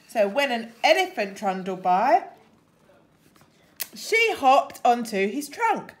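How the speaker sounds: lively storybook reading; reading to children. Acted. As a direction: read it like a picture book for kids. Reads a picture book to children.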